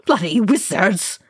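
mild howl of outrage. A narrator's reading. Dwarf cursing the wizard who stands alone, howling. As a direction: howl the curse in exasperation. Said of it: "Oin howled".

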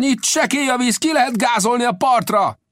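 very high, urgent shout; captain's alarm cry. A narrator's reading. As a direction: call all hands to flee. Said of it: "kiáltotta a kapitány".

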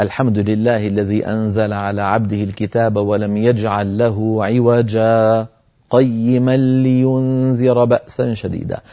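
slow, measured tajweed chant of the verse; Quran recitation. Shown as a demonstration. Teacher reciting the verse to his students. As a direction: recite it as in prayer.

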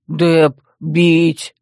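heavy, loud brute warning. A narrator's reading. Dep warns the man who stared, brutish threat. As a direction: threaten like a slow primitive brute. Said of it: "и предупредил".

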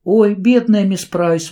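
put-on girl exclaims over hurt teacher; pitying exclamation. A narrator's reading. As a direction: cry out in pity for her. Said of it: "воскликнула Кэрри".

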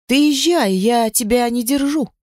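high-pitched, emotional release; tearful. A narrator's reading. Woman tells him to leave, tearfully. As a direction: let him go with a sob held back. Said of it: "всхлипнув, отвечаю".

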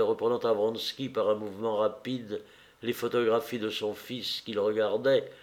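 ordinary delivery

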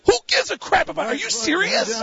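high, fast incredulous squawk; comic high-pitched protest; retold outburst. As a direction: blurt it in hammed-up disbelief. Retold argument with friend.

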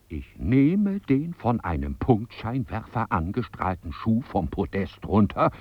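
ordinary delivery

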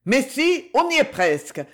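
plus haut et plus fort, on crie au portable; cri au téléphone. A narrator's reading. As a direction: rassure en haussant la voix, plus aigu. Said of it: "cria-t-on dans le portable". (le commandant au portable rassure José, en criant)

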